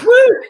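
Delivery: very high, loud "woo"; excited whoop. Retold or imitated. Retelling friend hopping on stage to fans, excited whoop. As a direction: whoop like a hyped-up performer.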